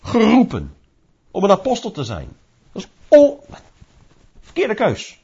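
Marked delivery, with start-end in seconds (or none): 2.7-5.2 objecting believer. Pretend. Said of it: "zouden we zeggen tegen de Heer".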